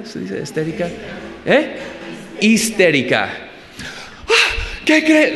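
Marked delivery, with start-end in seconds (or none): 4.3-5.3 imitando a alguien histérico, mucho más agudo. Retold or imitated. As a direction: lanza un grito agudo de pánico burlón. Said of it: "gente histérica". (imitación burlona de una persona histérica, muy aguda)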